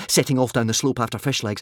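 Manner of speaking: ordinary delivery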